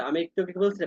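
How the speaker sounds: normal talking voice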